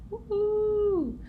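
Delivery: high, drawn-out whoop; excited cheer. Real, not acted. Cheering the return to drawing faces, high and excited. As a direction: let out a high, happy woo-hoo.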